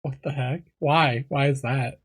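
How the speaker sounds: ordinary talk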